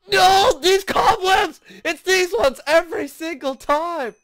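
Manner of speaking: exasperated rage. Real, not acted. Player raging at the cobwebs, exasperated yell. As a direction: yell it in real gamer frustration.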